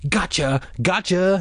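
high, repeated crowing; triumphant taunting. A narrator's reading. Boy pinned down still mocking, triumphant. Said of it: "he continued".

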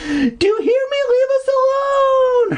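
very high, loud pleading scream; desperate shout. Acted. Cassie screaming at the hermit while running, very high and loud. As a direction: scream at him to go away. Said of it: "Her pleas".

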